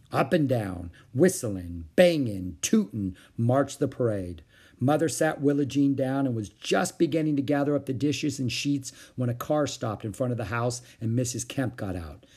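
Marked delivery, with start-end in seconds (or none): none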